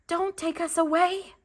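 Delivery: high, tiny pleading seed voice; crying seeds. A narrator's reading. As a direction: beg in a little high voice not to be taken. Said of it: "the seeds cried".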